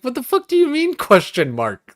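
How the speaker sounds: high, loud, disbelieving yelp; incredulous outburst. Retold or imitated. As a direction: blurt it out in outraged disbelief. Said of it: "but it's like".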